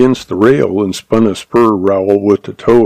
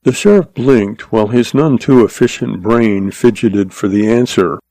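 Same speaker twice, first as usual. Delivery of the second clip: plain reading voice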